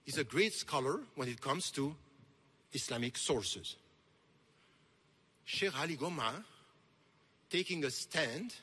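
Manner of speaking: ordinary talk, nothing marked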